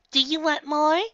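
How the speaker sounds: put-on high, loud, eager kid voice; young pony asking. A narrator's reading. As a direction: offer brightly in a childlike pitch. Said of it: "I ask".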